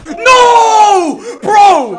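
anguished yell. Real, not acted. Dying in game.

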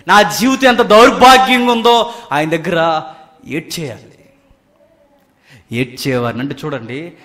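ordinary talk

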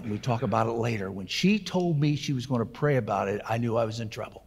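normal talking voice

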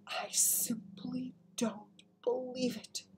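slow, high, whispery murmur; stunned murmur. A narrator's reading. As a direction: murmur it softly in disbelief. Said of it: "the Queen was murmuring".

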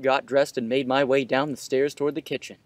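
usual speaking voice

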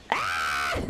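sharp low cry as she gets hit; pained shout. Real, not acted. Player taking a hit in game, sharp pained shout. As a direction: yell out in pain.

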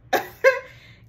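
sharp disbelieving laugh; real incredulous laughter; high loud laugh. Real, not acted. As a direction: laugh out at the insult. Laughs at being excluded.

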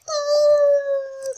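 high, drawn-out wail; sustained high panicked wail; startled cry. Real, not acted. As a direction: let out a long, loud high cry. Zombie attack in game.

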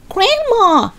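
high, panicked cry; boy crying out. Acted. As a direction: cry out in alarm. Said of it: "he cried out".

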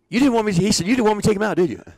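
high, loud retold scolding; the manager chewing out his coach; mock scolding. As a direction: chew him out like an angry manager. Bobby accusing.

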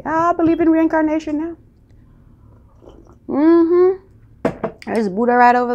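high playful aside. Real, not acted. Vlogger teasing viewers about reincarnation, high and playful. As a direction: voice jumps up high and light.

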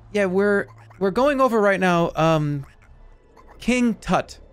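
lightly acted classroom teacher; mock teacher. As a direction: explain the lesson as a put-upon teacher. Acted teacher answering the student.

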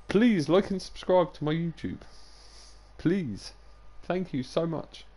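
high, pleading plug for the channel; emotional sales pitch. Shown as a demonstration. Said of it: "put more emotion into it".

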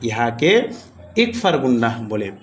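usual speaking voice

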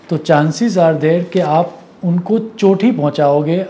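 usual speaking voice

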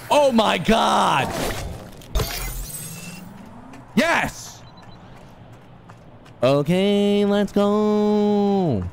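stretched-out shout of joy; excited shout. Real, not acted. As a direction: yell it out, thrilled. Excited boost jump success.

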